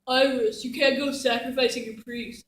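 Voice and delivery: lightly voiced scolding father; a hint of a stern dad; imitating dad. Goddess retells her dad's telling-off, lightly imitated. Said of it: "my dad was like".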